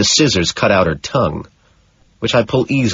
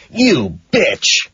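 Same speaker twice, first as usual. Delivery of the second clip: high yelled curse, pitch jumping up; shouted insult; 7 semitones higher than usual. Acted. Killer shouting at his bound victim, a sharp yell. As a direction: spit it out as a shout. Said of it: "I keep shouting".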